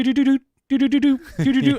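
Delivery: high, loud sung ringtone; hammed-up ringtone noises; ringtone imitation. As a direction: chirp out the ringing phone.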